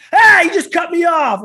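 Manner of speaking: high, loud, slow mock road-rage shout; imitated angry yell. Hothead friend yelling at a driver half a mile away, loud and angry. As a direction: play the hothead screaming at a far-off car.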